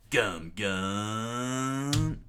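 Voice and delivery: loud battle call, stretched and rising; mild attack scream. Acted. Luffy running up the mast, calling his attack. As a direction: wind up the attack with a long cry. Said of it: "screaming out".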